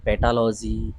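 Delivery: "पेटालॉजी" drawn out as pe-taa-law-jee; slow, syllable-by-syllable "पेटालॉजी"; common mispronunciation. Shown as a demonstration. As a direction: say "पेटालॉजी" with t and l in the middle. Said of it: "कुछ लोग इसे".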